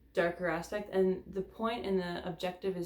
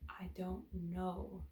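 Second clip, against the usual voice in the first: slow, soft whisper of "I don't know"; a slow, resigned whisper; 8 dB quieter, much slower than usual. Shown as a demonstration. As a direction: breathe "I don't know" slowly under your breath. Tapping statement repeated, whispered.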